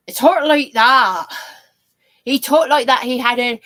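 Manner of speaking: loud, high-pitched rustic Sussex burr; Sussex accent. Retold or imitated.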